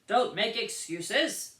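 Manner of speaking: loud, very slow, stern reprimand; loud, slow stern guard. A narrator's reading. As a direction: cut off her excuse. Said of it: "said the guard".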